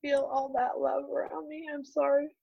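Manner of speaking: high, softened voice close to crying; apologetic, real choked-up gratitude; tearful. Real, not acted. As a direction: overcome with grateful emotion. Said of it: "I'm gonna cry thinking about that".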